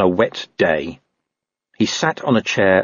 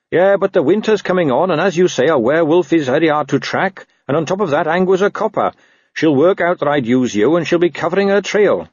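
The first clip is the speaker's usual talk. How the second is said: higher-pitched, reasonable watchman; lightly voiced reasoning Carrot; 8 semitones higher, 3 dB louder than usual. Watchman feigning doubt to coax a dog, lightly voiced and reasonable. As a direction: lay out objections, voice raised in pitch.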